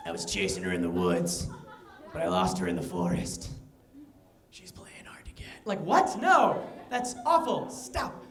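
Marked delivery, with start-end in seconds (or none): 0.1-3.5 very low, eerie killer voice with a stifled laugh; a serial killer chatting to a friend about a chase; creepy serial killer. Retold or imitated. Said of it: "A serial killer talking". 4.5-5.5 breathy killer whisper; a serial killer whispering the punchline; creepy whisper. Retold or imitated. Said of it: "A serial killer talking".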